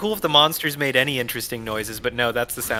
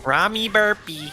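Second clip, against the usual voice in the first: higher silly voice on the name; put-on voice; 7 semitones higher than usual. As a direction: say it like a goofy character. Repeating the name in voice.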